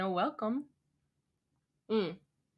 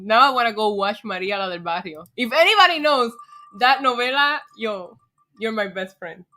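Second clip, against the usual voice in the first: ordinary talk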